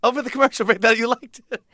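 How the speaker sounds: high, mock protest; indignant young comic. Young [name] protesting to the DJ, mock indignation. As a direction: call out the hypocrisy. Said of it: "I go".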